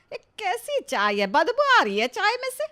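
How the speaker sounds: high, loud disgusted complaint; disgusted outburst. Acted. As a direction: recoil from bad tea with a cry. Mother-in-law disgusted by tea.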